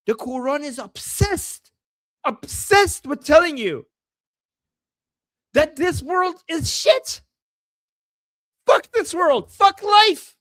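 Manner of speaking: high, forceful, punched-out words; heated outburst. Real, not acted. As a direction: build to a shout on the curses. Heated rant on scripture's message.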